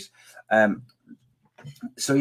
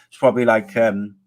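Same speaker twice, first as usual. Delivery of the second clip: ordinary talk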